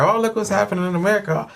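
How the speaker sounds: quick, high mock Kiwi accent; lightly mock a Kiwi watching the news; a hammed-up Kiwi accent, fast and high; bad Kiwi accent. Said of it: "my bad Kiwi accent".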